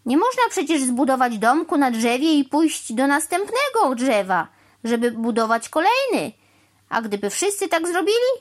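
high, loud, climbing string of questions; indignant rant. A narrator's reading. Crow insisting she use her tree house, indignant. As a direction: reel off objections in agitation.

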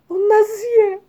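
high, loud terrified wail; panicked scream. A narrator's reading. Ryjek fears the monster fish will eat them, screaming. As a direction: scream it as if about to be eaten. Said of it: "krzyknął Ryjek".

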